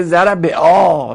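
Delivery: "ضربا" said zarabe-aa, with an e before the long a; awkward kasra pronunciation. Shown as a demonstration. Teacher shows kasra before alef is hard, "ضربا" as zarabe-aa. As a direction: stretch "ضربا" into a clumsy zarabe-aa. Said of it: "اگه کسره بیاد".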